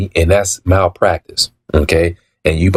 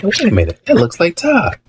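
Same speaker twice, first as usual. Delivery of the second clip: much higher, startled "wait a minute"; surprised recognition; 11 semitones higher than usual. Retold or imitated. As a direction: react like you just spotted someone you know. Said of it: "So I see, I said".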